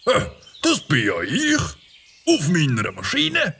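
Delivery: light up at a gift; delighted surprise. Acted.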